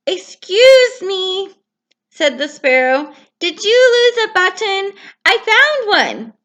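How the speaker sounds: small helpful sparrow; polite sparrow. Acted. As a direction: chirp it politely.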